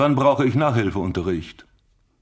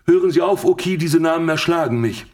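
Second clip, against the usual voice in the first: exasperated outburst; 7 semitones higher than usual. Acted. Kommissar wehrt ab.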